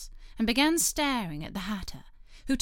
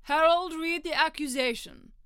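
high, commanding royal voice; king voice; 7 semitones higher, 3 dB louder than usual. Acted. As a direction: call out like a pompous king. King's command in court.

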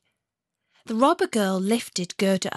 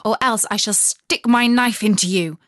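put-on stern robber girl; 6 dB louder than usual. A narrator's reading. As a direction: tell her firmly to stay put. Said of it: "said the little robber girl".

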